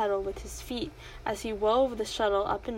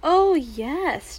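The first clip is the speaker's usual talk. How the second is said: dreamy echo; 7 semitones higher, 8 dB louder than usual. A narrator's reading. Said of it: "echoing her mother's dreamy tone".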